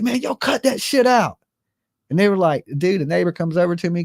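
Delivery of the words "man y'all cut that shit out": firm command. Retold or imitated. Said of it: "I was like".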